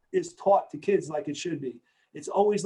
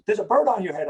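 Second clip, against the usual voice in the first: raised, higher-pitched retold call; mock alarmed holler, louder and higher; wife calling out; 4 semitones higher, 6 dB louder, faster than usual. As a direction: imitate a wife shouting down to her husband. Acts out wife calling to him.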